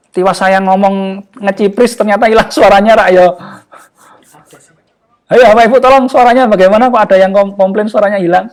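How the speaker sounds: real exasperation as the sound drops out; hey, where did my sound go; exasperated complaint. Real, not acted. Frustrated host, audio keeps cutting out.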